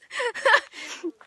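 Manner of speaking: high burst of laughter; sudden laugh. Real, not acted. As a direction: laugh it off while the bugs bite. Swatting mosquitoes mid-reading.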